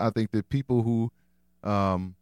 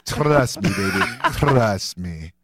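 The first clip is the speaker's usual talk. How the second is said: put-on freaky vampire croon, louder; 3 semitones lower, 5 dB louder, much slower than usual. Husband redoing his line for the hosts, hammed-up vampire. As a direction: ham up the creepy vampire coaxing. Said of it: "it's kind of a freaky vampire".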